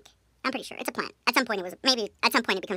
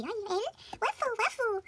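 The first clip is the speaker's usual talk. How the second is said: high squealing mock voice; 10 semitones higher, 6 dB quieter, slower than usual. Teasing her friend about a crush, high mocking squeal. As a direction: squeal it like a lovestruck girl.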